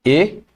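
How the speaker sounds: "A" as a clear "ay"; acute-accent E sound. Shown as a demonstration. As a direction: say "A" as French é. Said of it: "for this accent".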